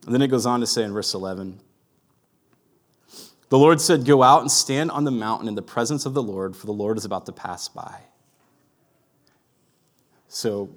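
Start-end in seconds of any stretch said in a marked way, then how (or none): 3.5-8.0 raised, ringing Bible reading that fades at the end; scripture reading. Acted. Pastor reading the verse aloud to his congregation, proclaiming. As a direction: proclaim the verse to the church, then let it settle.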